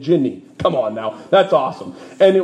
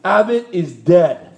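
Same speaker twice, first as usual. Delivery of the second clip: grave announcement; 2 semitones higher, 4 dB louder, slower than usual. Retold or imitated. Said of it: "blasts into ... and announces".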